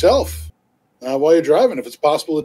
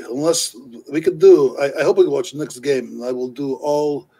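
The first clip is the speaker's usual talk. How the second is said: put-on terrible Slavic accent. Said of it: "my terrible Slavic".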